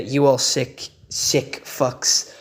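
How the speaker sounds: ordinary talk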